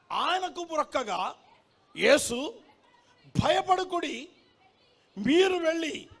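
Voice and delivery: high, ringing proclamation with long pauses; declaimed scripture reading. Acted. Preacher reading the risen Jesus's greeting, declaimed scripture. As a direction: proclaim each phrase to a whole hall.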